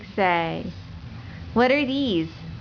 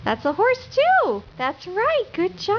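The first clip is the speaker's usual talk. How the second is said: very high praising voice; 9 semitones higher than usual. Real, not acted. Praising toddler.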